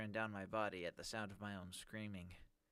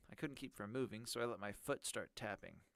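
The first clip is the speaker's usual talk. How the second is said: normal talking voice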